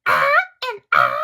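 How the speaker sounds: loud hammed-up chimp mockery; mocking cry. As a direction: ham up a cartoon chimp mocking her. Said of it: "he's fucking mocking her".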